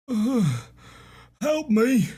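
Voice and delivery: high, pained plea from a beaten prisoner; wounded captive. Acted. Beaten prisoner begs his finder for help, high strained plea. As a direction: beg weakly in a strained high voice. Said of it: "and he says".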